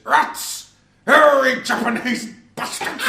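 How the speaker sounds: put-on loud, high shouted Irish accent, drawn out. Quoting Father Ted to friends, loud, high Irish accent shout. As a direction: bellow it in a comic Irish accent. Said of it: "the moment the Irish accent starts up".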